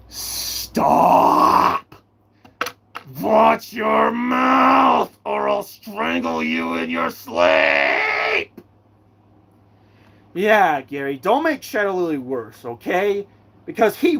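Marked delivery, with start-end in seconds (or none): 0.1-8.5 menacing shout. Acted. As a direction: bellow the threat slowly. Villain threatens in play.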